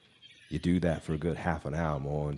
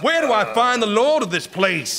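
high, loud, ragged yell; frantic shout; 10 dB louder than usual. Retold or imitated. Man screaming at lost souls for the Lord of hell, frantic shout. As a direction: scream it into the dark at full force. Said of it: "I asked".

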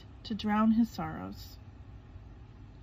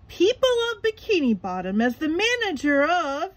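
high, loud, puffed-up sponge speech; grand speech; 7 semitones higher, 7 dB louder than usual. A narrator's reading. SpongeBob on stage addressing the crowd, high and loud. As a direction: orate to the crowd. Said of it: "he started to say".

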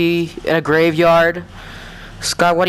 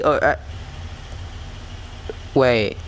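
usual speaking voice

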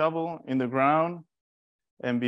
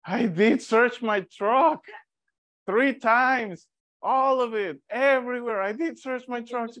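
high, drawn-out mock indignation; indignant insistence; 10 semitones higher than usual. Brother answering sister about searching the truck, indignant. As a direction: insist high-pitched, leaning slowly on the first words.

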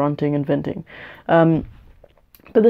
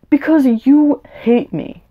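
higher and louder, slowed for weight; hurt accusation, pitched up and dragged out; indignant outburst; 8 semitones higher, 4 dB louder, slower than usual. Real, not acted. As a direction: throw it back at the relatives who hate you. Talking back to her hostile relatives.

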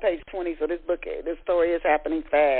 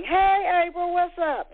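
much higher and louder, sung-out hello; upbeat sing-song greeting; 11 semitones higher, 3 dB louder, slower than usual. Acted. Clarissa greeting her sister on the phone, upbeat sing-song. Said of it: "She was upbeat as she sang".

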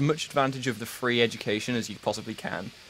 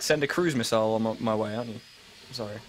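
ordinary talk

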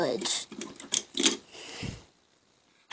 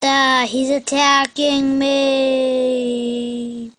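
ordinary talk

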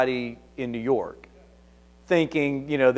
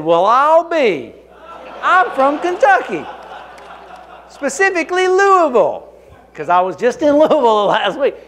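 higher, louder, gushing and laughing through it; mock delight; 11 semitones higher, 9 dB louder than usual. Faking delight to a caller on a pretext call, much higher and louder with laughter. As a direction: ham up the amazed hometown act.

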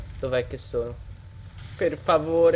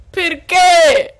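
Perché? yelled higher and louder; exasperated cry; 7 semitones higher, 5 dB louder than usual. Real, not acted. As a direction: throw Perché? out in outrage. Repeated in disbelief. (Perché? gridato più alto e forte)

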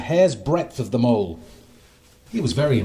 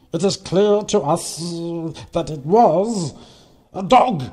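higher, louder fly voice, stretched sounds; buzzing flies; 5 semitones higher, 5 dB louder than usual. A narrator's reading. Flies telling the mole the culprit, buzzing announcement. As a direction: buzz out the verdict in pieces. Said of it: "buzzed the flies".

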